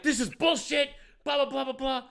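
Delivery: high-pitched mock rant; retold angry complaint. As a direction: imitate a ranter, dismissing it with blahs. Acted angry customer grumbling.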